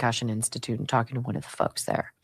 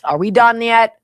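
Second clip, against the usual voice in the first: louder bored impatience; impatient; 6 semitones higher, 8 dB louder than usual. Pretend. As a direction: hurry them out, a bit louder. Impatient doctor voiced in quote.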